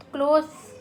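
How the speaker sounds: क्लोथ्स said loud, with the final s sounded clearly; teacher calls out क्लोथ्स, loud and firm, ending on a clear s; clothes with s. Shown as a demonstration. Teacher stressing the plural to the class, loud and clear. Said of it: "हमेशा उसमें ईएस का use होगा".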